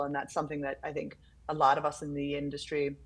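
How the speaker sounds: ordinary delivery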